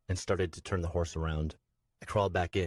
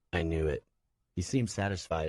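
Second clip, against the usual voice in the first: ordinary delivery, nothing marked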